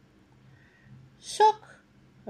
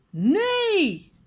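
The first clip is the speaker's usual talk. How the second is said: called out; 11 semitones higher than usual. A narrator's reading. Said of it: "Hij roept".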